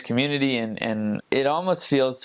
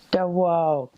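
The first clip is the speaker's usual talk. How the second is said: higher, mock-angry Quebec French; imitating an angry lady; 7 semitones higher than usual. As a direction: mimic a mad Quebecoise's telling-off. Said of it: "this lady was very mad at us".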